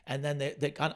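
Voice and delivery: ordinary delivery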